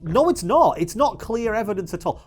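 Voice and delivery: high, heated contradiction; getting angry. Real, not acted. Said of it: "I'm getting angry again".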